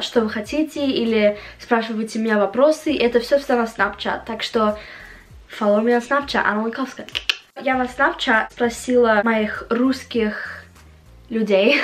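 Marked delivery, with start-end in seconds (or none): none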